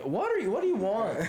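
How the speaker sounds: high, incredulous retort; mock exasperated. Retelling a spat with the neighbor, high and incredulous. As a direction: toss it back in disbelief.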